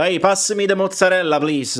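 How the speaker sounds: mock Italian accent to amuse the dinner guests; stupid Italian accent. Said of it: "do a stupid Italian accent".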